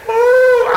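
high, loud "ooh" of praise; real preacher's whoop; joyful whoop. Real, not acted.

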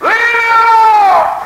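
high, loud, drawn-out holler; retold refusal shouted. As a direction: holler it long and high to be left alone. Climax of retold refusal to wife.

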